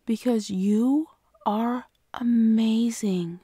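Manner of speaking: slow, tender whisper. Acted. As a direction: drop to a breathy reassuring whisper. Reassuring a sad partner.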